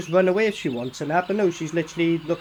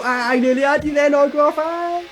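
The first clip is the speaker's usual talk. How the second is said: higher, louder playful taunt; mock play-fighting mate; 6 semitones higher, 5 dB louder than usual. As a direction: act out a mate's near miss. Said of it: "He's gonna be, stop and go".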